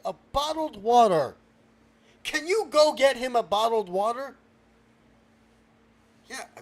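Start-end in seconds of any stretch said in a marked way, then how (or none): 0.0-1.4 BOTTLED WATER loud and raised in pitch; exasperated repeat. Acted. Host repeats it louder, exasperated. 2.2-4.3 loud, raised-pitch order; exasperated request. Acted. Host orders water in exasperation.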